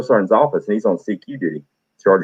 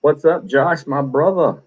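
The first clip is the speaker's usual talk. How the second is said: higher, upbeat shout-out; friendly greeting; 7 semitones higher, slower than usual. Real, not acted. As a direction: greet a buddy in the chat. Greeting viewer in chat.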